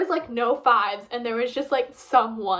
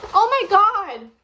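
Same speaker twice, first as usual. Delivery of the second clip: excited gasp; 7 semitones higher, 6 dB louder, slower than usual. Real, not acted. Tasting food, sudden delight.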